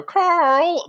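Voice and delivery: high, loud cry of Carl; llama yelling Carl. Retold or imitated. Imitating the llama sketch shouting for its friend, high and loud. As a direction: yell it out like a silly llama. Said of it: "the llamas go".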